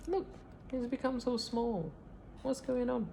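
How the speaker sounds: very high squeaky little voice; tiny hen voice. A narrator's reading. Shrunken hen squeaking in panic, very high.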